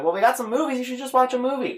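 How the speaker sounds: high-pitched imitation of mom; mom's suggestion. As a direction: play a mom coaxing a bored kid. Voicing mom suggesting a movie.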